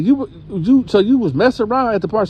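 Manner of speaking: high, rushed mock accusation; accusing. Grandma grills her girl about the party, accusing. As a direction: act out a suspicious mother pouncing.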